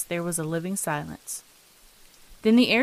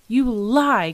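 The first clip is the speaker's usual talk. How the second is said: louder, forceful accusation; accusing cry; 3 semitones higher, 7 dB louder than usual. A narrator's reading. Father accuses his son of lying, forceful cry. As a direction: cry it out at him. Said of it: "cried the other, in a half-stilled voice".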